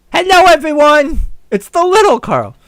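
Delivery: put-on high, loud child's greeting; little boy. Six-year-old Carl greeting gala guests, loud little-boy voice. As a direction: chirp it like a small boy on stage. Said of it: "say hello to all of the nice people".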